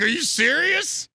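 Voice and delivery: high, loud, slow incredulous question; disbelief. Retold or imitated. On the phone to Heather, high loud shocked disbelief. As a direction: gasp it out in shock. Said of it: "I was like".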